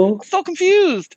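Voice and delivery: high, slow, silly confused voice; hammed-up confusion in a high voice; mock confused voice. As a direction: play it up baffled. Mock accent banter.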